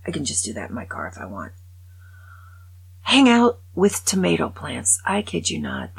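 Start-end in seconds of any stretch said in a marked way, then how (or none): 3.0-5.0 louder, punching Hang; loud disbelieving read; read out incredulously. Shown as a demonstration. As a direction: announce "Hang out with tomato plants" like an absurd headline. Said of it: "That is what this says".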